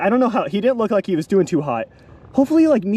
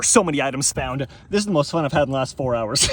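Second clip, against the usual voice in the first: normal talking voice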